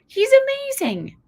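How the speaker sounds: high, loud, delighted parent praise; gushing praise. Retold or imitated. Oh, I would say.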